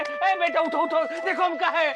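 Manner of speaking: high, urgent rousing; startled, high-pitched panic; alarmed. Acted. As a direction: shake the child awake with your voice. Alarmed, waking child in temple.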